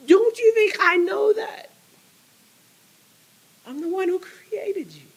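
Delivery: put-on God pleading with his doubting child; God's voice. God answering the doubter, high and breaking. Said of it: "And God goes".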